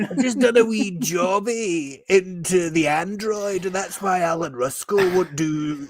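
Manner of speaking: high hammed-up Scottish accent; mock Scottish Barrowman. Riffing as Barrowman on the willy scandal, mock Scottish. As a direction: camp Scottish ranting, played for laughs. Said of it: "become Billy Connolly".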